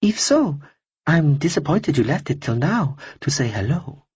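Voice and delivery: a cool, polite stranger brushing him off; lightly voiced woman. Woman character voice.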